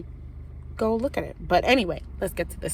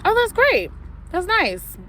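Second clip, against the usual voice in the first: much higher, sing-song fake delight; bright fake cheer; 12 semitones higher than usual. Teller mimicking her bubbly reply to Taylor, fake cheer. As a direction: gush with fake enthusiasm.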